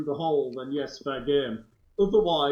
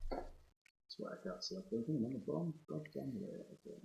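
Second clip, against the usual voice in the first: softer grumbling to himself; muttering; 6 semitones lower, 11 dB quieter than usual. Real, not acted. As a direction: complain under your breath.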